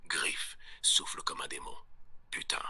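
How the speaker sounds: usual speaking voice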